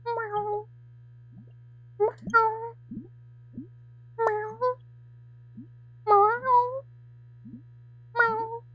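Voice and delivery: high-pitched cat meows, one at a time; meowing cat. Acted. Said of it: "a dweller just has to go".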